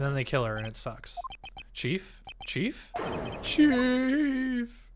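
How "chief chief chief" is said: calling out. Acted. Calling for Mia in game.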